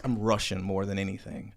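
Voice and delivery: normal talking voice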